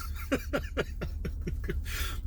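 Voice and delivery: soft, breathy chuckle; laughing. Real, not acted. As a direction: let out a long quiet laugh. Laughs after joking go for yours.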